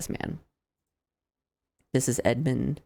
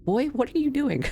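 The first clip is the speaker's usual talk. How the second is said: surprised; 12 semitones higher than usual. Real, not acted. Startled by the creeping Duke.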